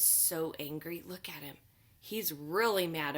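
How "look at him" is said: usual speaking voice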